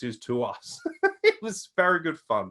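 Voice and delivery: ordinary talk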